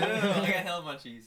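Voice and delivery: slow, laughing munchies line. Real, not acted. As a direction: chuckle through it. Laughing repeat.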